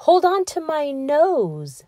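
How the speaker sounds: high, slow sly whisper; sly whispering fox. A narrator's reading. Fox whispers slyly to lure the gingerbread man closer. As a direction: whisper the trap, slowly. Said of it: "said the fox".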